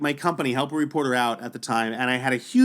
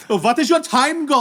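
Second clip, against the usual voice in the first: higher, louder mock German accent; German athlete; 8 semitones higher, 5 dB louder than usual. German athlete asking a first-timer's goal, mock German accent. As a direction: imitate a German rival pressing the question. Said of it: "German athlete".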